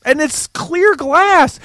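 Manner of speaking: high, slow, loud mock protest; exasperated retort. Son pointing out clear glass to dad, exasperated high shout. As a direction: spell it out loudly, word by word. Retold exasperated reply continues.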